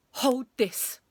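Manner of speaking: firm instruction; ordering. A narrator's reading. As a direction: give a brisk teacher's order. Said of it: "he ordered".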